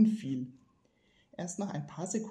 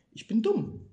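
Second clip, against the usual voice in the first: self-scolding; 7 semitones higher, 5 dB louder than usual. Acted. Dursley scolding himself.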